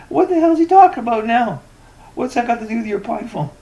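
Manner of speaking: high-pitched, incredulous outburst; exasperated. Real, not acted. Ranter baffled by the moderator's tangent, exasperated. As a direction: burst out high in real exasperation. Ranting at the moderator's off-topic reply.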